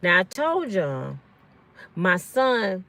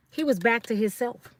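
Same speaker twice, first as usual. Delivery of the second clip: ordinary talk, nothing marked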